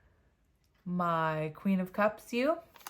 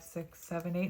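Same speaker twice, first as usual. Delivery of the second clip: normal talking voice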